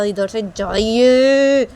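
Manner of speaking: loud, long-drawn order to leave; angry dismissal. Acted. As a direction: stretch it out in an exasperated shout. बेटा मां को भगाता है. (झुंझलाकर खींचकर चिल्लाना)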